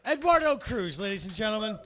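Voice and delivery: loud, high MC announcement; announcer call. Real, not acted. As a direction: sell the name to the room. Host announcing comic.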